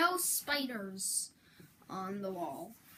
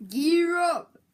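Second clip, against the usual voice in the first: higher, louder hype call; hype announcer; 6 semitones higher, 10 dB louder than usual. Acted. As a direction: pump up the crowd. Mock announcer shout.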